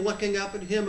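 ordinary talk, nothing marked

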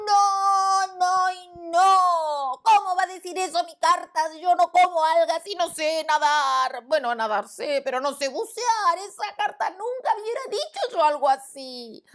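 loud, high, drawn-out "no y no"; outraged lion. Acted. Lion to the frog, loud high outrage. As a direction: protest indignantly.

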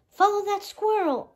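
high, loud command to chase; rally the fairies after the thief; fairy barking orders to follow; ordered. A narrator's reading. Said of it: "ordered Merryweather".

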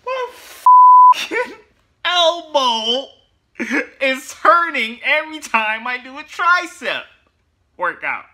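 high, loud whiny wail; complaining lifter. Retold or imitated. Aching lifter whining loudly about his elbow. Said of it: "you're saying".

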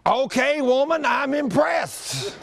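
high, stretched-out mock amazement; impressed husband. Husband conceding to his wife after the downpour, hammed-up amazement.

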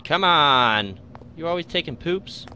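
loud exasperated yell; frustrated shout. Real, not acted. Frustrated at the game.

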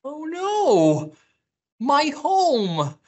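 high, loud storybook wail; dismayed high yell. A narrator's reading. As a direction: cry out over a ruined home. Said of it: "yelled Beaver".

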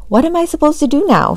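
high, fast demanding mom. A narrator's reading. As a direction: demand it sharply, high and quick. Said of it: "she demanded".

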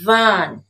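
Van, repeated loud and clear; model word. Shown as a demonstration. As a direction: model Van for the class to copy. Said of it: "Now listen and repeat".

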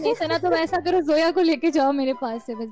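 ordinary talk

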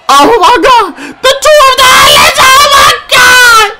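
excited shout. Real, not acted. Fan watching the aliens arrive, loud high shout. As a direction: shriek it as the surprise entrance hits.

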